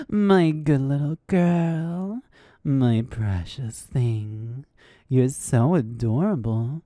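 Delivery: slow, doting mommy dom praise. Shown as a demonstration. VTuber praises a listener as a mommy dom, slow and doting. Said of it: "like a mommy dom who wants to take care of you".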